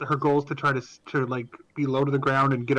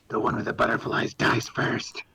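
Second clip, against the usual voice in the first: low, hushed threat; Shock's menacing voice; 3 semitones lower than usual. Acted. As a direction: whisper it like a deadpan killer. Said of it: "Shock is saying".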